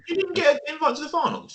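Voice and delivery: high-pitched, disbelieving question; incredulous. Real, not acted. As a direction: check it in real astonishment. Disbelief at missing finals invite.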